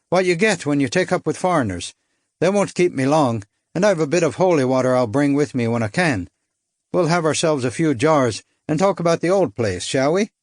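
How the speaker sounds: Irish lilt. Acted. Irishman inviting his countryman for drinks, light Irish lilt. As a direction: promise a drink like an old pal from Mayo. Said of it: "I couldn't place his accent".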